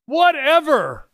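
high, slow, drawn-out brush-off; emphatic dismissal. Real, not acted. Preacher to atheists, repeating it high and slow. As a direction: really lean into the shrug this time.

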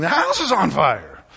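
high, loud panicked yell; wild banshee yell. Retold or imitated. Wife bursting in on husband, yelling like a banshee. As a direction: burst in screaming the alarm. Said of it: "come in like a wild banshee yelling".

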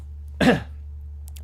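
harsh hacking cough; coughing fit. Real, not acted. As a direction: cough hard, then recover. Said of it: "Excuse me".

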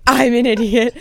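loud mock self-put-down; self-deprecating whine. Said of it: "Rebecca's like".

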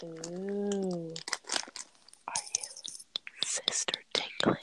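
soft ASMR whisper. Pretend. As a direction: whisper it close to the mic. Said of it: "We can do a A S M R episode".

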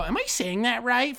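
high, fake-innocent teacher asking; teacher checking a name she just butchered; mock teacher. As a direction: ask it like you already know you got it wrong. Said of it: "and then they go".

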